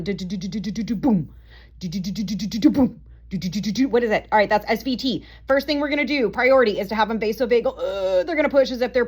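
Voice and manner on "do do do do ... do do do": fast do-do-do beats ending in boom; vocal rhythm strip. Shown as a demonstration. Teacher beatboxing the SVT rhythm for students. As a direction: sing out the heart rhythm. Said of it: "What is this one?".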